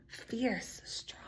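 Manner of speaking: fierce then strong, fading to a whisper; soft echo. Shown as a demonstration. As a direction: let the words drop to a hush. Echoing words softly for child.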